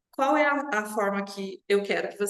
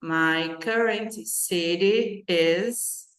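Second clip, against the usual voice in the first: slow model sentence; much slower than usual. Shown as a demonstration. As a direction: stretch each word out slowly. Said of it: "flat T".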